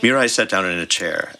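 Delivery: ordinary delivery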